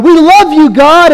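gushing praise. Retold or imitated. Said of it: "They're like".

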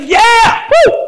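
high, loud cheer of joy; excited whoop. Retold or imitated. Barnabas cheering at the Spirit's move, loud and high. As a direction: whoop it up like a thrilled believer. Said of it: "He's excited".

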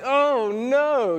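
high, loud realizing gasp of dismay; sudden high oh no. Retold or imitated. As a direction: caught red-handed. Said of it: "And I'm like".

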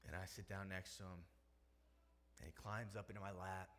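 ordinary delivery

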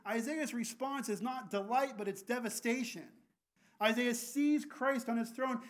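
usual speaking voice

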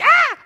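high, loud mock shriek of fright; startled scream. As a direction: imitate a lady's sudden scream. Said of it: "you were like".

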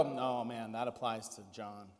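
a quiet self-satisfied "that's about John"; mildly smug, blaming someone else; smug deflection. Retold or imitated. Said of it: "do you look around and go".

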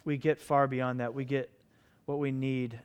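soft, low closing prayer; hushed pastoral prayer. Acted. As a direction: pray quietly over the congregation.

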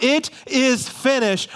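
high, drawn-out cry from the pulpit; mock yell. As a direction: proclaim it slowly, pitched high. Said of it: "when he yells out".

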